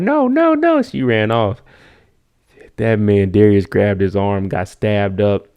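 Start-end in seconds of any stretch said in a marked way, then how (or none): 0.0-0.8 panicked no. Retold or imitated. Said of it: "She said".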